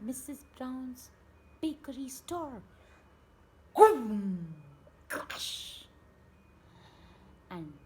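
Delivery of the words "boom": shouted boom sound effect; big vocal boom; loud boom. A narrator's reading. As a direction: boom it out like a crash.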